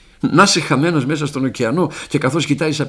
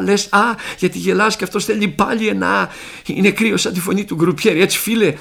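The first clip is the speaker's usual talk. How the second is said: high, raised, agitated voice; excited outpouring; 6 semitones higher, 3 dB louder than usual. Acted. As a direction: let the words tumble out, heart fluttering. Overjoyed prisoner babbling to neighbour.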